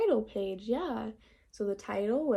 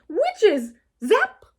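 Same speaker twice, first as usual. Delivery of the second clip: much higher, louder scared shriek; frightened cry; 10 semitones higher, 8 dB louder than usual. Acted. Froggy scared in bed imagining witches, high loud shriek. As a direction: shriek as a spell zaps you.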